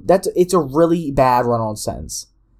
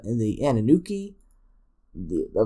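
ordinary talk

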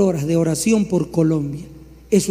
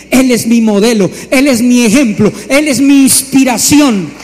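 loud, emphatic declaration; rising proclamation; 3 semitones higher, 7 dB louder than usual. Real, not acted. Preacher proclaiming Jesus as his model, loud and building. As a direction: proclaim it louder each time.

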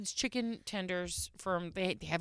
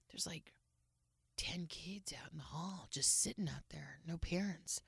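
whispered, much softer; hushed whisper; 5 semitones lower, 12 dB quieter than usual. Retold or imitated. Wife imitating husband reporting kids in the hall, hushed whisper.

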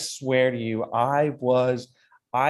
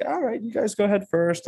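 lightly voiced Scottish local, much higher; a hint of the polite local resident, pitched up; local golfer's invitation; 10 semitones higher than usual. Retelling the Saint Andrews locals inviting them to tee off, lightly voiced. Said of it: "I'm not gonna do the Scottish accent".

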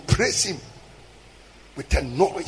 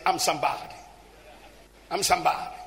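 lightly imitated American accent; 4 semitones lower, 8 dB quieter, much faster than usual. Preacher quotes his friend in Takoradi, mock American accent. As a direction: retell it with American vowels. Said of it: "he used to speak like an American".

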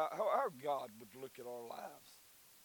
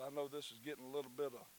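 usual speaking voice

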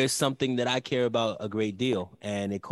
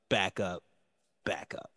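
each backup lower and softer, dying away; fading echo; 5 semitones lower, 5 dB quieter than usual. Pretend. Mocking the backup quarterback with a fading echo. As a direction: let backup echo and fade out like a canyon.

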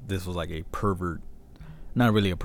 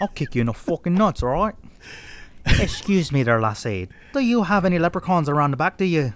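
higher comic British-Irish brogue; put-on British accent; 7 semitones higher than usual. Host joining the accent game, hammed-up British-Irish accent. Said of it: "Give me a British accent".

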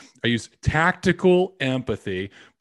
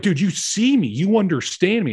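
excited, higher and louder thanks; grateful client; 8 semitones higher, 6 dB louder than usual. Retold or imitated. Client thanking the salesman, higher and louder, excited. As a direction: burst out with relief at being understood. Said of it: "they're like".

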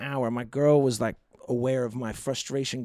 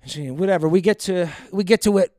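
ordinary talk

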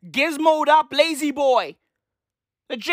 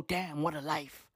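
very soft, low acted musing; hushed, low reflection; wistful reminiscing; 8 semitones lower, 14 dB quieter than usual. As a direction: murmur it like someone looking back fondly. Old people looking back, repeated.

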